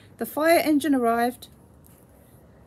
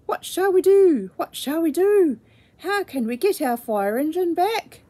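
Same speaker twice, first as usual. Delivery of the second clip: worried cry; 6 semitones higher, 5 dB louder than usual. A narrator's reading. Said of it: "they asked".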